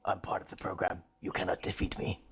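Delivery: ominous villain whisper; booming echoing villain monologue. Pretend. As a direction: whisper it like a sinister machine. Menacing villain joke, whispered.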